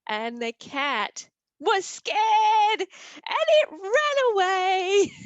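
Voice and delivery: high and loud, gleeful; excited triumph. A narrator's reading. Storyteller revealing the cat fleeing, excited. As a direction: tell the cat's defeat with delight.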